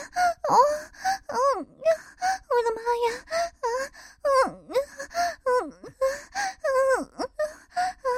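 very high, acted moaning cries; moaning outcry. A narrator's reading. Mother crying out to the narrator, high moaning voice. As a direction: cry out high between broken words. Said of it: "柳青一下子大吼了出来".

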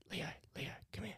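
hushed whisper to the dog. Real, not acted. Whispering to the sleepy dog Leo to come over. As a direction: whisper his name so he wakes gently.

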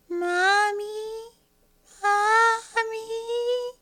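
put-on high, loud child's wail for mommy; little girl calling. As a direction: cry out like a small scared girl. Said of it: "She's like".

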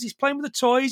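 ordinary delivery

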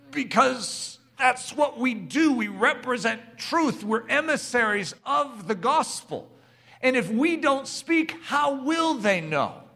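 rally the congregation to speak up; impassioned preaching. Acted. Pastor urging the church to speak truth, impassioned preaching.

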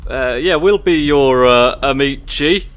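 amici said with a mock Italian flourish; proper Italian. Player accepts the dinner invite, mock Italian amici. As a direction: lean into amici like a real Italian. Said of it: "with the most, like, proper".

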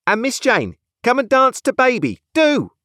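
put-on high, insistent call to a child; high-pitched coaxing command; bossy nurse. A narrator's reading. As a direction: summon the child like a harried nursemaid. Nurse summons the little girl.

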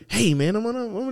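high, put-on chummy greeting; fake friendliness. Employee flatters the owner, mock fake-friendly voice. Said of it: "give me this fake ass".

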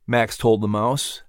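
plain reading voice

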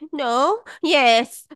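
high, loud mock-scared kid; high-pitched imitation of a child's confused confession; imitated scared child. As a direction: blurt it like a flustered, scared child. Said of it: "she's now getting scared".

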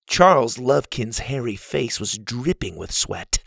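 usual speaking voice

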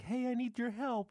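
put-on very high squeaky child voice; shrill put-on child falsetto; creepy kid at the door. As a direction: squeak it out like a pleading little kid.

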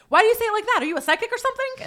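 high, loud, rapid outburst; mock alarmed questioning. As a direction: imitate a freaked-out assistant blurting questions. Startled assistant questions the client.

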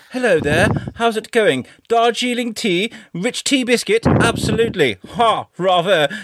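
high, hammed-up posh British accent. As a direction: send up an upper-class British toff, high-pitched. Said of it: "I ain't that posh either".

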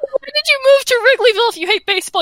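can't believe what he's hearing; incredulous question. Retold or imitated.

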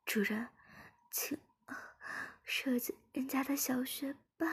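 high, soft, breath-broken begging; halting high character voice; moaning plea. Acted. Acted character continues moaning while pleading.

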